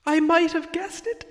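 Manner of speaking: high, light hobbit voice; timid hobbit. Acted. Bilbo humouring the dragon, high and meek. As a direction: pipe it up meekly.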